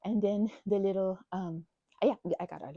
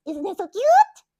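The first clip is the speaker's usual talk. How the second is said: much higher, louder gush over cute; 11 semitones higher, 12 dB louder, slower than usual. Real, not acted. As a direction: swoon over the plushie. Showing off the plushie.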